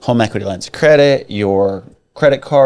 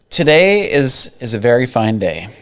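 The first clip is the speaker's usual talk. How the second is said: normal talking voice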